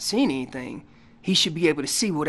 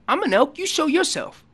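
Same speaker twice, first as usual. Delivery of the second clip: voicing an elk's challenge; 9 semitones higher than usual. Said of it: "All I'm telling them is".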